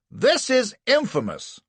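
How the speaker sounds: high, loud, slowed outburst; roaring. A narrator's reading. Anderson erupts at the prosecutor's reading, roaring. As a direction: roar in outrage at the court. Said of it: "roars Anderson".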